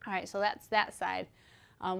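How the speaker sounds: usual speaking voice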